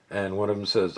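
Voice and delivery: ordinary talk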